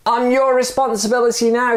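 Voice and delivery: high, firm declaration; insistent. Acted. Stranger insisting from the rope, high firm voice. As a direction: lay the burden on him. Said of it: "said the other".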